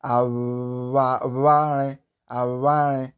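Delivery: aware drawn out, air squeezed through the lips on w; squeezed lip sound. Shown as a demonstration. Teacher demonstrating the digraph, squeezed lip sound. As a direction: aware with a long buzzing vw in the middle. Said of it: "like I'm trying to squeeze air through my lips".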